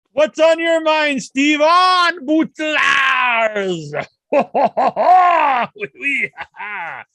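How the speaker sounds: put-on loud hammy Indian accent shout. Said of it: "uh, Indian accent".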